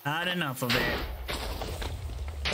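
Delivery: slow menacing then sharp suffer; slow villainous gloat. Acted. Villainous destruction.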